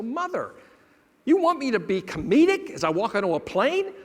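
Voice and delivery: high-pitched, exasperated son; incredulous protest. Retold or imitated. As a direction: object to mom's absurd request. Son protests to his mother.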